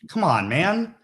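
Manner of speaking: speaker, put on the spot by the host, protests in a high rush; embarrassed protest. Real, not acted. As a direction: squirm and plead to stop filming. Embarrassed, asked on camera.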